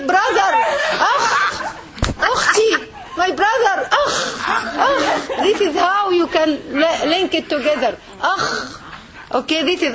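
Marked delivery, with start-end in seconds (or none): 0.0-5.3 higher laughing calls of brother and akh; mock cry for help. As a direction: play-act calling out when hit, laughing. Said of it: "what you say?".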